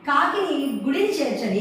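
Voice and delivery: usual speaking voice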